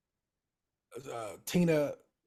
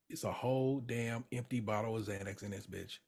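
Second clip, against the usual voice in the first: much softer, low discovery; stunned hush; 4 semitones lower, 11 dB quieter than usual. Retold or imitated. Carmy finds the empty pill bottle, hushed. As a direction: mutter it in disbelief.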